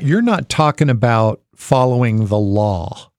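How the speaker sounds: ordinary delivery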